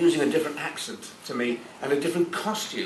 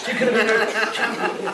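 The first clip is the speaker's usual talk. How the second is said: higher, louder on could, laughing; 9 semitones higher, 4 dB louder than usual. Real, not acted. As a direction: land the punchline with a laugh. Finishing the joke.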